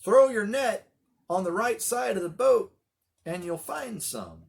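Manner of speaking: confident hail from shore; calling out advice. Retold or imitated. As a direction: call the tip across the water. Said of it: "this heckler from the shore says".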